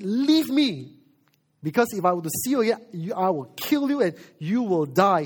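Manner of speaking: loud, high outburst with a rushed threat; angry Pharaoh. Acted. Pharaoh threatening to kill Moses, angry. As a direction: order him out in anger. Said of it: "He's so angry".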